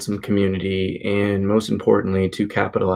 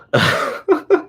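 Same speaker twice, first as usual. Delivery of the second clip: quick, higher-pitched chuckle; short laugh. Real, not acted. Host relieved the audio works, short laugh. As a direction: let out a brief little laugh.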